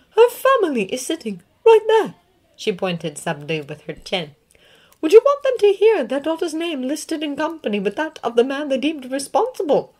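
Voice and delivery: a stern witch recounting a loss; McGonagall. Acted. McGonagall points out the family.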